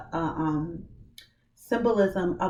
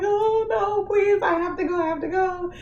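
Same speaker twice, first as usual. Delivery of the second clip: high, near-sung desperate pleading; retold frantic cry, much higher; 13 semitones higher, 3 dB louder than usual. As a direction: beg in panic to get away. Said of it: "I'm frantic".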